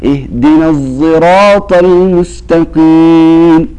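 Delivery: الصراط said with its ص tinted toward a z sound, slowly; demo: الصراط with ص half-way to ز, drawn out; sad with a hint of z. Shown as a demonstration. Teacher shows students the ishmam reading, ص tinted toward ز. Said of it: "فتقول".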